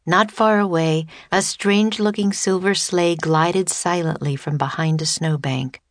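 usual speaking voice

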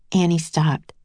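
ordinary delivery, nothing marked

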